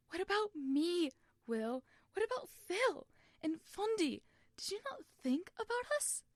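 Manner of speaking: put-on betrayed boy screaming at Will; tearful shout. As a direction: yell it through tears at your brother. Tommy shouting at Wilbur in tears.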